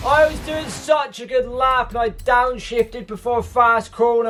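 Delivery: real anguish, pitched far up; anguished shout. Real, not acted. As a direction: cry out at your own mistake. Continues the wailing complaint.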